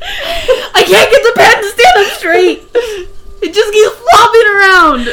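high squealing shout through laughter; shrieking with hilarity; loud laughing shout. Real, not acted. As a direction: yell it while losing it laughing. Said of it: "how loud this one is".

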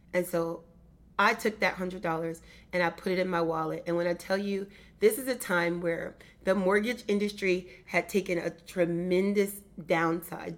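normal talking voice

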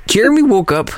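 wired, high excited call; too much caffeine. Real, not acted. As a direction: say it buzzing with energy. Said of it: "I'm so wired right now".

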